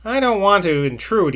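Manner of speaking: usual speaking voice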